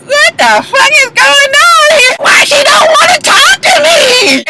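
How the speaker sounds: high, drawn-out squealed imitation; mock outraged wail. Imitated bartender snubbed by the star, mock high wail. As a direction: wail it out, hammed-up and stretched.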